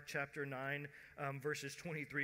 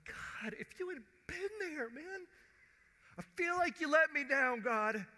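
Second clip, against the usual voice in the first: high, strained protest building louder; anguished complaint to God; 12 semitones higher, 7 dB louder than usual. Acted. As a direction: start thin and high, build to a loud lament. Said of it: "even they struggled like we struggle".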